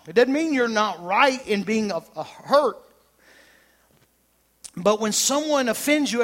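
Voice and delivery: loud, high, driving preacher voice; building sermon fervor; full-force preaching. Acted. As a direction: preach it hard to the congregation.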